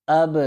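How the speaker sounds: اب with the ب released in a short echoing bounce; qalqala bounce. Shown as a demonstration. Tajweed teacher drilling students, اب with a bouncing ب. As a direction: let the ب in اب bounce out. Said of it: "یہاں پر جنبش پیدا کریں گے".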